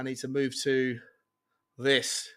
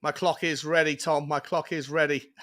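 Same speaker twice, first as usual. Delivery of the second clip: normal talking voice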